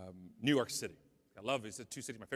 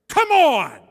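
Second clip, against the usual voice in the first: yelled all out, much higher and louder; furious shout; 10 semitones higher, 17 dB louder than usual. Retold or imitated. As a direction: explode at the crew who cut in. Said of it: "and I let loose".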